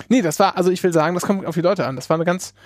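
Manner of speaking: usual speaking voice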